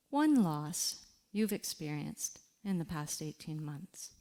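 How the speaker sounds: soft, unhurried reflection guide with long pauses; walk the listener gently into their loss; guided reflection. Acted. Grief coach guiding listeners through a quiet reflection, softly.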